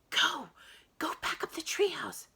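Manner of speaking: high, urgent stage whisper; frantic whispered order. A narrator's reading. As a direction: hiss it to send her back up. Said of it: "Jack whispered".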